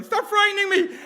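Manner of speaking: very high, loud panicked shriek; imitated scream. Kid swinging the stick, shrieking back at teenagers.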